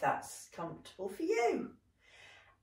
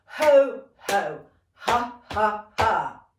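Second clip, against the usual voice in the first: punchy warm-up chant, loud first beat; with conviction; 7 dB louder, slower than usual. Shown as a demonstration. Teacher chanting along with the class, with conviction. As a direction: let the breath push out each beat. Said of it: "say that with real conviction".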